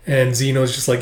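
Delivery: usual speaking voice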